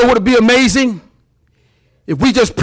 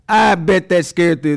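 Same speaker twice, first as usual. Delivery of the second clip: ordinary talk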